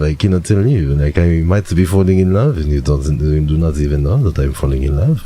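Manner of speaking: put-on French accent, love-struck; hammed-up French accent with swooping emphasis. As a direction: play a smitten French romantic. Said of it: "you still have a French accent".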